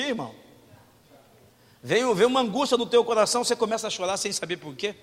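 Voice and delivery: normal talking voice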